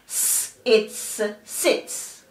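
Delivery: long hissed "s", "its", then "sits" joined; sounding out and blending. Shown as a demonstration. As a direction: split "s, it's" apart, then blend to "sits". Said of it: "So the syllable is".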